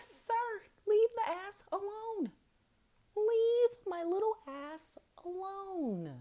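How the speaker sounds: high, slow drawn-out plea; hammed-up high-pitched begging; mock pleading. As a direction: beg him high and slow to stop. Telling him to stop.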